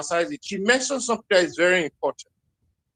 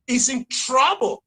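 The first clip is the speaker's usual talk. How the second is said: ordinary delivery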